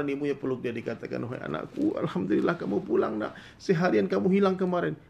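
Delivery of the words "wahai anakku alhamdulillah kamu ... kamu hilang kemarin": higher, louder relieved mother; mother hugging her lost son, calling out in relief. Acted.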